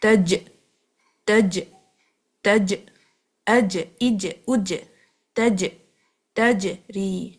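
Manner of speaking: تج repeated with bouncing jeem; تج, تج with a released bounce; bounced jeem drill. Shown as a demonstration. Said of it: "مطلب bounce کریں آواز".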